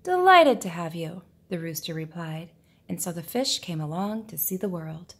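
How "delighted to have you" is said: louder, booming welcome; hearty welcome. Acted. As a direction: throw the door wide with your voice. Rooster welcomes the fish.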